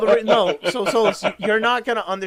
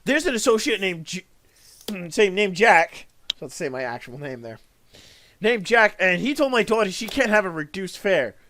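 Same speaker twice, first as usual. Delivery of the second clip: angry mother; 3 semitones higher, 6 dB louder than usual. Retold or imitated. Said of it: "and she goes".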